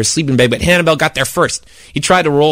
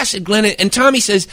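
ordinary delivery, nothing marked

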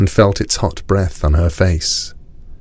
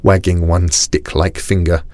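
ordinary delivery

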